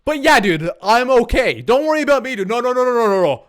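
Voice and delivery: hammed-up panicked insistence; frantic mock reassurance. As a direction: blurt it high and loud, playing down a disaster. Acted frantic call to friend.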